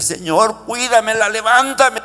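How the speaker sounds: father's frantic prayer; anguished plea. Retold or imitated. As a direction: beg the Lord for his daughter. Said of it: "le decía".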